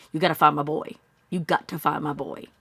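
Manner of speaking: usual speaking voice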